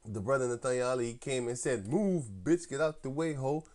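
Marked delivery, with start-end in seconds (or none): 1.9-3.6 mock [name] shouting, slightly higher and louder; [name]-style shout. A member retelling the brother's [name]-style "move" line. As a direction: bark it like the [name] song, lightly. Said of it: "on a [name] tip".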